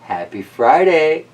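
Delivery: usual speaking voice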